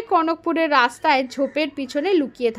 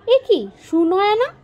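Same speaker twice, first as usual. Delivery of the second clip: startled, higher and louder; surprised; 6 semitones higher, 4 dB louder than usual. Acted. King startled as the princess walks in. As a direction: caught off guard by his daughter's entrance. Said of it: "রাজা অবাক হয়ে উঠলেন".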